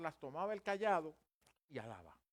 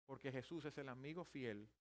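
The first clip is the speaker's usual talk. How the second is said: ordinary talk, nothing marked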